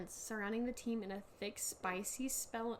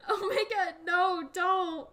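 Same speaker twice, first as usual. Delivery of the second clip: pleading cry; 7 semitones higher, 8 dB louder, slower than usual. Acted. Acted plea as Tech eaten.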